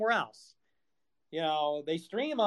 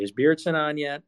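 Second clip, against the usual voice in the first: normal talking voice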